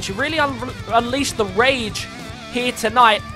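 usual speaking voice

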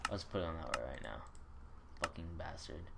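usual speaking voice

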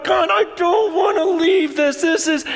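high-pitched, overwhelmed outburst to God; overwhelmed plea. Retold or imitated. Preacher retelling his plea to stay, overwhelmed. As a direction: cry out in amazement, pitched well up.

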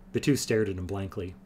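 usual speaking voice

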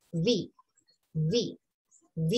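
"V" as low, soft buzzing "vee". Shown as a demonstration. Teacher models gentler English V, soft buzz. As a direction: ease into "V" with a lip-and-teeth buzz. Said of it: "Y es más suavecito".

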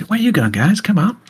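put-on chirpy kid keen to make friends; young mech tech introducing himself to the leaving crew; eager young tech.

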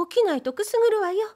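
wife playfully threatening to tickle him; teasing wife. A narrator's reading. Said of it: "まだふざけ足りないと見え".

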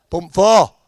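loud, muffled tannoy call; tannoy announcement. Retold or imitated. Kiosk woman calling a pump number over the tannoy.

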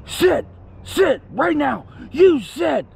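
high, slow mock-screamed dog command; sharply pitched, drawn-out mock order; imitated screaming. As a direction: mimic a bossy shout without full volume. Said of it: "she's screaming at Kit".

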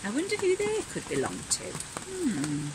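high, quick wondering voice; puzzled little creature. Acted. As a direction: sound curious and puzzled.

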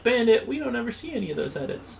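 high, fast mocking viewer voice. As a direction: toss it off like a cheeky viewer. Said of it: "You're like".

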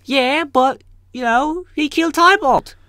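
high, loud accusing lady voice; shrill Lady Capulet. Acted. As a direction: screech the accusation at the prince.

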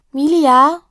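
put-on high, loud little girl's call; child calling out. A narrator's reading. Little Jessie calls her sister from the living room, high child's call. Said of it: "ruft Jessie aus dem Wohnzimmer".

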